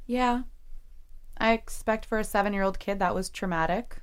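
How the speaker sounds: ordinary talk, nothing marked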